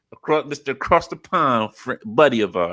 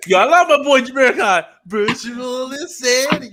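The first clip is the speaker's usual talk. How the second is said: excited shout; 11 semitones higher, 4 dB louder than usual. Real, not acted. Gushing over a favorite artist.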